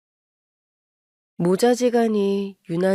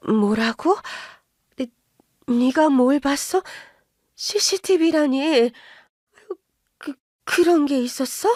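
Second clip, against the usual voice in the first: higher, scolding mother berating her son; mother scolding her son for siding with in-laws, higher and indignant; 4 semitones higher than usual. Acted. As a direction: nag him, pitch climbing with outrage. Mother startled and flustered.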